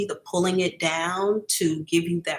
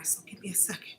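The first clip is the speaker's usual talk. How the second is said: a choked whisper; real tearful whisper; holding back tears; 14 dB quieter, faster than usual. Real, not acted. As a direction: whisper it while fighting tears. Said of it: "Okay, I'm about to cry".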